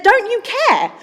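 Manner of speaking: high, loud indignant appeal; accusing plea. Acted. Disciples waking Jesus as they fear drowning, accusing. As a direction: throw it out as a pleading accusation.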